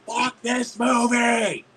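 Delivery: high, drawn-out holler; mock heckler yell. Heckler opening the theater role play, mock yell. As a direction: play the heckler, stretching every word high. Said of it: "let's do the role play".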